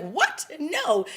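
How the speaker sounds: imitated disbelief, pitch leaping up; incredulous refusal. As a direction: balk in mock disbelief. Retold shocked refusal.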